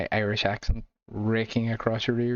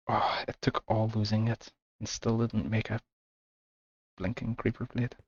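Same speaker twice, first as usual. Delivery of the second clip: frustrated whisper; 3 dB quieter than usual. Real, not acted. Crafting fails, mutters to self.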